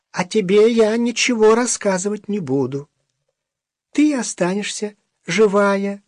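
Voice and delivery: high, gentle begging little man; pleading. Acted. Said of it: "попросил".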